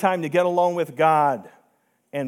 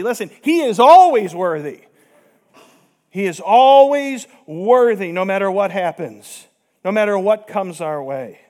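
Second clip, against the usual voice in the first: emphatic preaching; 8 dB louder than usual. Real, not acted. Preacher insists God is worthy.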